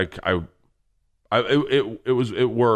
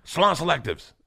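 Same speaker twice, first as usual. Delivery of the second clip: pitched-up mock yell at normal volume; mock shout; 7 semitones higher than usual. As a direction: blurt it like an involuntary thought. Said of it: "my brain shouts shit out".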